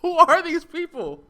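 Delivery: very high, loud, incredulous squawk; utter disbelief. Real, not acted. Gamer hears Red Dead Two insulted, utter disbelief. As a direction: shriek it as if you can't believe your ears.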